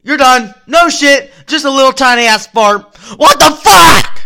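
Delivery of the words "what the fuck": loud, drawn-out disbelief; shocked outburst. Retold or imitated. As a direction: yell it in shock. Shocked at a tiny fart.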